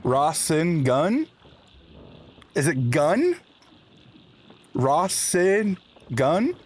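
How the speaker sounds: Rasen gun slowed down, gun rising like a question; trying out Rasen gun. Shown as a demonstration. Streamer checking the name with chat, slow and questioning. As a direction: test Rasen gun slowly, leaning on gun. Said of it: "Did you guys hear him yell at me".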